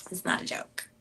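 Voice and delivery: whisper. Real, not acted. Whispered repeat, unvoiced.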